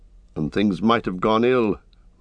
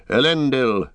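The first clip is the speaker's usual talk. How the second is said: raised-pitch battle cry, restrained in volume; 6 semitones higher than usual. A narrator's reading. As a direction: invoke the name, falling at the end. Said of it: "he cried".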